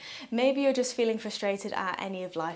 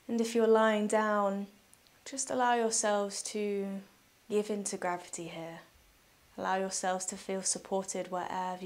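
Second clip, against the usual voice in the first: soft, unhurried meditation voice with long pauses; guided meditation; 2 semitones lower, 6 dB quieter than usual. Real, not acted. Yoga teacher guiding listeners through breathing, calm guided meditation.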